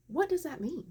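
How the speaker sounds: high, swooping disbelief; incredulous repeat. Retold or imitated. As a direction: ask it high-pitched, utterly baffled. Baffled patient asks the nurse again.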